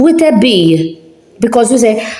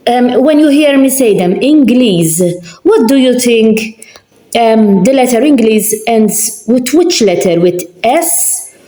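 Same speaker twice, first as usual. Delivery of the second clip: ordinary talk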